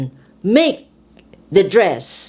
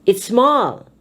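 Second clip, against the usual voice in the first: "small" raised, then falling; stress on SMALL. Shown as a demonstration. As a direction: put force into "small". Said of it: "put force into adjectives".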